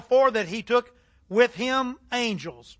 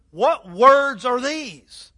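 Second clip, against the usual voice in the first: slow, louder, wondering question; amazed; 3 semitones higher, 7 dB louder, much slower than usual. Acted. Amazed crowd asks each other, slow loud wonder. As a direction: marvel aloud, stretching each word. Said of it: "all the people were amazed".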